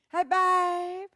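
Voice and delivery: high, loud sultry greeting; flirty hey babe. Pretend. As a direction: purr it like a showgirl. Greeting viewer playfully.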